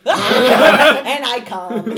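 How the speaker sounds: slow, loud, laughing through it; laughing shout. Acted. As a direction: burst out laughing while speaking. Laughing while acting out entrance.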